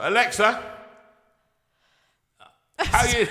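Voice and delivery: calling out to Alexa. Pretend.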